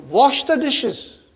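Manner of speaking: drawn-out household command; parental command. Shown as a demonstration. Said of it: "you tell your daughter".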